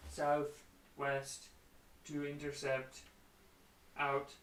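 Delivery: flat, evenly spaced machine delivery; robot transmission in a level monotone; monotone robotic voice. Acted. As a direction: read it as a programmed message, halting between phrases. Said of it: "in a very monotone, robotic voice".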